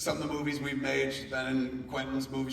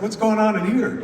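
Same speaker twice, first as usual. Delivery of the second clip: calling out loud into the room; bursting in with a loud, nosy call; loud nosy dad call; 8 semitones higher, 11 dB louder than usual. Retold or imitated. Barging into the library.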